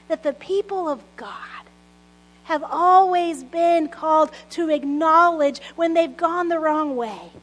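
ordinary delivery

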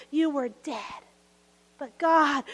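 ordinary talk, nothing marked

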